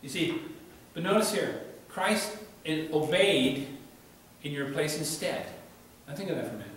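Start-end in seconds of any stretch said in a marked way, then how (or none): none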